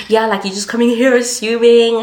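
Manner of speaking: acted-out loud reproach; retold indignation. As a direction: scold like an indignant friend, imitated. Friend scolds teacher in retelling.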